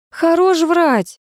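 high, stretched indignant outburst; slow, high-pitched flash of anger; flaring up. A narrator's reading. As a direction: call out the liar, pitch jumping up. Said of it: "взвилась Лена".

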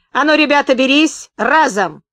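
high, loud rallying call; shouted command. A narrator's reading. Gunman ordering his lads onto the luggage, shouted command. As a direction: call the gang to action.

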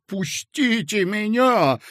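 high, slow desperate squeal. A narrator's reading. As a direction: shriek to be let go. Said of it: "взвизгнул Коротков". (взвизгнул)

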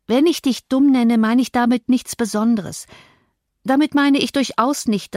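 refuse, then press your appeal earnestly; defiant, pleading Cathy. Acted. Cathy demands recognition from her cousin, insistent pleading.

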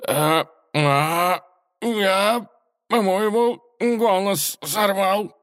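cracked, very high strained croak; hoarse broken voice. Acted. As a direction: squeak it out in horror, voice gone. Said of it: "в ужасе прошептал он".

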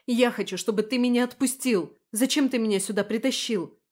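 put-on girl protesting to the man who dragged her in; angry demand. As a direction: demand to be let go, outraged. Dragged into bathroom, she demands.

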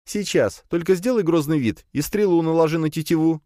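put-on laughing girl's voice. A narrator's reading. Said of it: "засмеялась принцесса".

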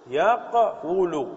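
slow "يقول" split as yaq-wu-lu; underlying form. Shown as a demonstration. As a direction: stop on the q, then sound the waw in "يقول": yaq-WU-lu. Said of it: "أصلها".

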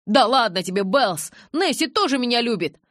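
loud, high-pitched cajoling; exclaimed, defensive appeal; protesting. A narrator's reading. Jacob protests to angry Bella.

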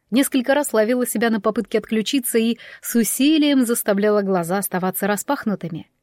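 usual speaking voice

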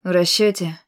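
wry smirk. Acted. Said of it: "усмехнулся он".

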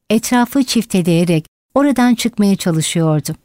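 ordinary delivery, nothing marked

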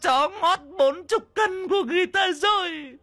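extremely high village-wide shriek; screeching curses. Acted. Mother across the village curses Đồng, screeching. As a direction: screech and wail over the loss. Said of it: "cũng gào mồm".

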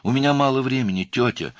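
high, loud urgent cry; shouting. A narrator's reading. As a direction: shout it impatiently into the phone. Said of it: "крикнула девушка".

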